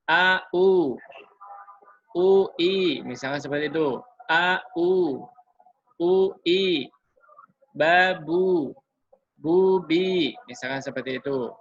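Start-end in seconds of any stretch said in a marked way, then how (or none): 0.1-10.3 louder, each syllable set apart; demo reading. Shown as a demonstration. Teacher shows how to read dhammah syllables, loud and even. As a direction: read it out as the model for students. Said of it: "Misalkan ini kita ketemu ini".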